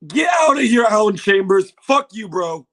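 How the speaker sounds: ordinary talk, nothing marked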